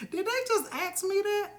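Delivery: imitating her reaction to a nosy question; mock disbelief. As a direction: act out shocked disbelief. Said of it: "and I'll be like".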